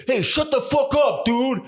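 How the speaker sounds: high hammed-up New York accent yell; mock a tough New York guy, New York accent, pitched high. Guy on the plane telling another to shut up, mock New York accent. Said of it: "some guy with a thick New York accent".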